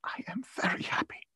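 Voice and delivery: high, soft, breaking into whisper; moved near-whisper. A narrator's reading. Bereaved father to his loving children, whispering. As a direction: whisper it, overcome with feeling.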